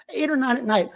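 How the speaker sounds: usual speaking voice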